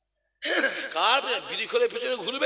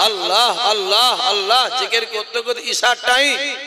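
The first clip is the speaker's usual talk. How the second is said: rhythmic preaching building to full volume; chant-like preacher's momentum, swelling steadily; impassioned sermon storytelling; 4 semitones higher, 13 dB louder than usual. Real, not acted. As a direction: drive the miracle story up to a loud climax.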